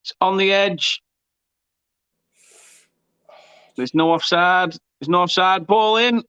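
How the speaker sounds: real play-by-play erupting into a high-pitched roar; live goal commentary. Real, not acted. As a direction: call the equaliser with soaring, stretched-out excitement.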